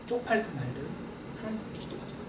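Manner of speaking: breathy hushed vow; whispered resolve. Acted. Resolving to pray regardless.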